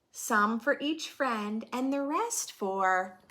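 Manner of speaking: put-on lively read-aloud for kids; children's story narration; storybook reading. A narrator's reading. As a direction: read the rhyme to a class. Reading picture book aloud.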